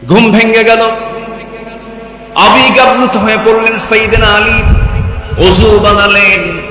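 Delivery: high, loud bursts with dramatic pauses; full-force preaching. Acted. Preacher telling of the sudden waking, full-force preaching. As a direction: proclaim each phrase loudly, pitched high.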